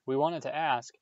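ordinary delivery

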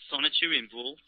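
ordinary talk

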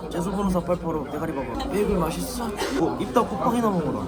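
a veteran actor impression that keeps cracking up; Lee Kyung-young impression. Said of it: "이경영 선배님 성대모사 한번 하겠습니다".

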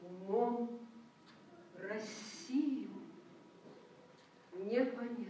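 slow, word by word, each word set apart; solemn poetry recital. Acted. Reciter to the concert hall, slow and solemn. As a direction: recite it as a grave declaration.

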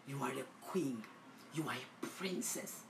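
soft breathy assurance; tender whispered blessing. Real, not acted.

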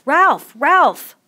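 high, loud cry as if hurt; sharp, high-pitched shout. A narrator's reading. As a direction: call the name like a yelp of pain. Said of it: "cried out as though he had hurt himself".

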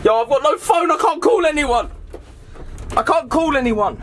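high-pitched, desperate complaint; distressed protest. Acted. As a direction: plead in rising distress. Trapped without a phone.

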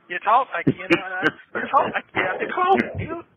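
real furious yell; furious shout. Real, not acted. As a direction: blast it back at him in real anger. Angry retort to friend on phone.

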